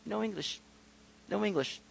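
very soft, offhand brush-off; hushed, self-satisfied dismissal; smug refusal. Retold or imitated. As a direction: imitate a smug man feigning no English. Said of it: "with a smug look on his face".

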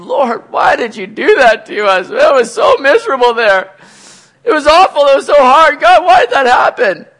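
high, loud wail of protest; hammed-up anguished complaint; loud complaining. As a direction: cry out to God as if freaking out. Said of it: "They're not complaining".

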